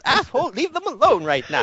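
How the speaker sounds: high, clipped order from a cranky professor; professor scolding. Acted. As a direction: tell the kid off in your high professor voice. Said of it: "the professor says".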